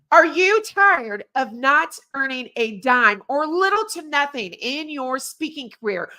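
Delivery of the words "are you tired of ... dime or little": ordinary delivery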